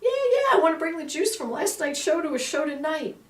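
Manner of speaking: high, eager rush of yes; eager, excited. Retold or imitated. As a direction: jump in, thrilled to say yes. Eager reply to her father's voice.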